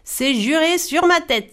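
high, eager promise; exclaimed oath. A narrator's reading. Voracious spirit promising the spider, exclaiming. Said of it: "s'exclama l'esprit vorace".

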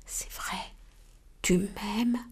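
soft breathy whisper. Acted. The delighted ant whispers back to the dove. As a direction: murmur it in wonder. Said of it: "la fourmi murmura".

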